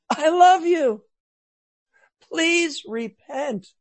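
put-on a father pleading with a straying child; loving plea. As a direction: beg them gently to come back. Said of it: "the emotion of love is even stronger".

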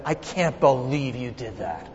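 low, hushed disbelief, retold; soft, low replay of scolding a son; understated retold reproach. Father to son over a bad report card, understated reproach. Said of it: "I yelled at him".

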